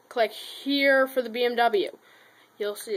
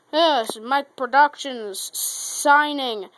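sign-off announcer; 5 dB louder, slower than usual. Real, not acted. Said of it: "channel sign-off".

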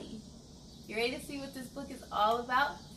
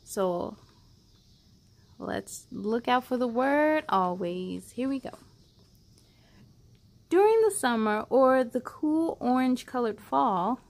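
louder, expressive picture book reading; reading to children; 10 dB louder than usual. Acted. Host reading a loving picture book to kids, expressive and louder. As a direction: read it out like a bedtime story for little ones.

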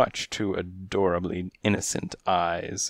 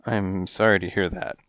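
ordinary delivery, nothing marked

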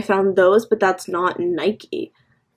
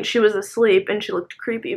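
ordinary talk

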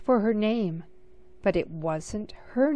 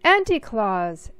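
louder call with a falling end; shouting again; 7 dB louder than usual. A narrator's reading. Sophie calling her aunt a second time, louder. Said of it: "Sophie shouted".

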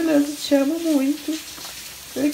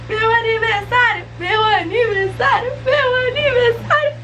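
high, tearful repeated cries of joy; choking up with happiness; teary joy; 7 semitones higher than usual. Real, not acted. Birthday girl overwhelmed at midnight, crying happy tears.

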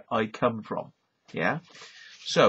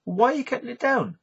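mock question; 11 semitones higher than usual. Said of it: "And you go".

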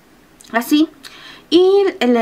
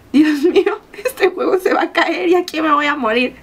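mock panic; 4 semitones higher than usual. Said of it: "Y yo dije".

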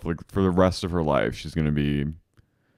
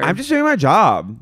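loud, high protest; mock defensive; 8 semitones higher, 6 dB louder than usual. Acting out Sheena defending her podcast, mock defensive. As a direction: imitate someone protesting an accusation.

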